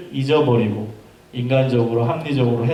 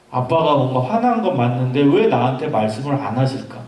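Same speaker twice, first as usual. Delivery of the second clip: ordinary talk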